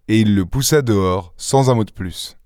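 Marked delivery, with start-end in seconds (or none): none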